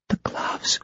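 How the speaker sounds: breathless frightened whisper; whispered terror. Acted. Ginger in trance, whispering in fear. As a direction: barely dare to say it.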